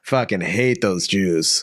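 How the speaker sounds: low, slow, soft grumble; grumbling Walt. Retold or imitated. Rejected Walt grumbling to himself, low and slow. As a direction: grumble it bitterly under the breath.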